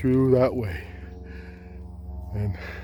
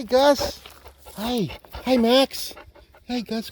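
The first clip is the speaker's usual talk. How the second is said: talking to a dog; 12 semitones higher, slower than usual. Real, not acted. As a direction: coo at the dogs. Cooing at dogs.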